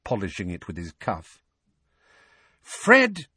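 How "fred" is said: loud, very high panicked shriek. A narrator's reading. Mother sees flying dishes about to crash, shrieking. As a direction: shriek a warning at the twins. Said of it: "Missus Weasley shrieked".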